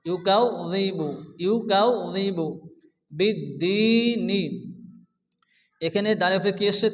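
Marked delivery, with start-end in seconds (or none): 0.1-2.5 ইউকাযযিবু slowly with doubled zal; slow tajweed demo. Shown as a demonstration. Teacher modelling ইউকাযযিবু slowly. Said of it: "কাফের সঙ্গে সংযুক্ত হয়েছে জাল". 3.2-4.4 বিদ্দীনি very slowly with doubled dal; slow tajweed demo. Shown as a demonstration. Teacher modelling বিদ্দীনি slowly. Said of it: "দালকে আমরা দুবার উচ্চারণ করব".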